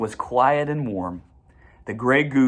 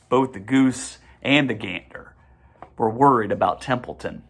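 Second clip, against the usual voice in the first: plain reading voice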